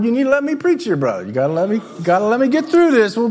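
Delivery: usual speaking voice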